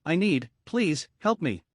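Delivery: ordinary delivery, nothing marked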